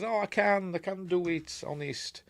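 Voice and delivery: high, soft, eager plea; mock pleading. Luke begging to be trained, high mock pleading.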